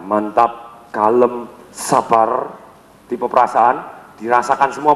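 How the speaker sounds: calm and heavy. Shown as a demonstration. Trainer demoing the feeling type's calm low voice. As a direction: settle low and patient. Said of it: "dengan penuh tekanan dan jeda".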